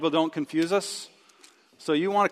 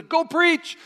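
much higher, bright and keen; eager farmer; 10 semitones higher, 4 dB louder than usual. Retold or imitated. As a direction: announce it like a sudden calling. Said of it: "he goes".

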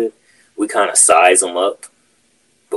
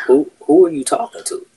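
higher, challenging retort, partly mocking; retold snapping back; 4 semitones higher than usual. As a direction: throw his words back at him, pitched up. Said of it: "I snapped. I was like".

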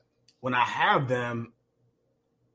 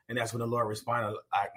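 normal talking voice

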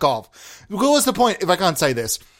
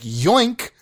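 loud yoink; 5 semitones higher than usual. Retold or imitated. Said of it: "You can't say yoink that loud".

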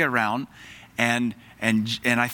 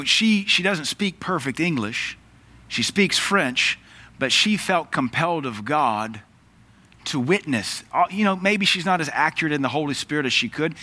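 ordinary talk

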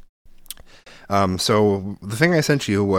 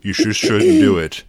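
mock preachy advice; 2 semitones higher, 7 dB louder, slower than usual. Mocking silly sex advice.

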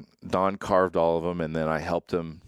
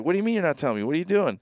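higher, insistent mock pestering; pestering friend; 10 semitones higher, much faster than usual. Buddy Don on the phone, pushing for the secret, in a high insistent voice. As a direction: demand an answer like a nosy buddy.

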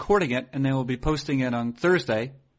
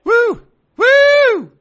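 whooping; 11 dB louder than usual. Real, not acted. Whooping to end the show.

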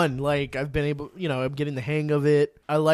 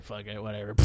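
much softer, careless shrug; nonchalant; 5 semitones lower, 13 dB quieter, faster than usual. Retold or imitated. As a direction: toss it off, no care. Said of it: "You just go".

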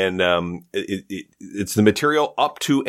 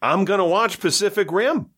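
high, mock-defiant declaration; defiant rant; 11 semitones higher than usual. Real, not acted. Defending DVDs to friend, mock-defiant and higher. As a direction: stake your claim with relish. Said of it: "defiant DVD rant".

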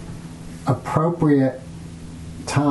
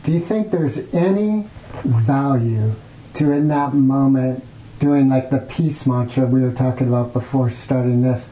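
ordinary delivery

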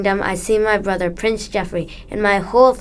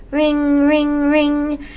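phone ring imitation; 6 semitones higher, much slower than usual.

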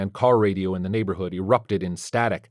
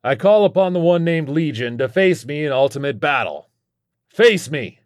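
higher, louder ringing challenge; declaimed challenge; 6 semitones higher, 5 dB louder than usual. Acted. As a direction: call out the demon to battle. Challenge shouted to a demon.

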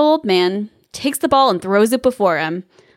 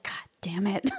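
put-on old man muttering a whispered curse; 13 dB quieter than usual. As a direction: hiss the curse under the breath. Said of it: "he's doing his old man".

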